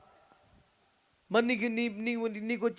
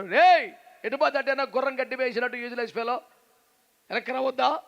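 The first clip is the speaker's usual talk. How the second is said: elders scolding; 5 semitones higher, 6 dB louder than usual. Retold or imitated. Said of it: "అనేవాళ్ళండి".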